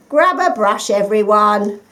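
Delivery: loud rallying call. A narrator's reading. Daddy Rabbit calls his family to clean up, loud. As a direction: rouse the whole family. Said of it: "cried Daddy Rabbit".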